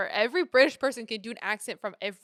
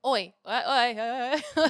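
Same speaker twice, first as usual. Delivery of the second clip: loud, higher mock English calls; English accent; 3 semitones higher, 6 dB louder than usual. Shown as a demonstration. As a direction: warm up the English accent, loudly. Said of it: "tapped into your English accent".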